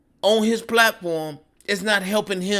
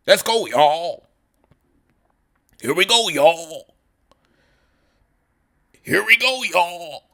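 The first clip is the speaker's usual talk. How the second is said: mock hype; 4 dB louder than usual. Mocking Kyle's hype intro.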